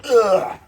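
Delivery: harsh grunt and hacking cough after a strong shot; whiskey cough. Acted. After downing grandma's corn liquor, burning hacking cough. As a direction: cough it out like the liquor burned going down.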